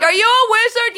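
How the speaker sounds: put-on high, loud little boy with a Boston accent; kid's Boston accent. Nephew hollers at the clerk, excited Boston accent. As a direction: pipe it up in Boston kid-speak. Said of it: "he has a Boston accent".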